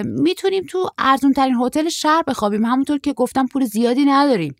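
put-on high, loud boyish voice; short surfer, carefree. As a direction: play a hopeful young traveller, pitched well up. Short surfer suggests cheap hotel.

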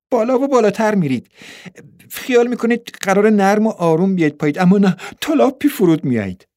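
put-on high, animated young girl; excited girl. A narrator's reading. Girl describes the parachute ride, waving her hands, excitedly. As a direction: thrill the listener in a high girlish voice.

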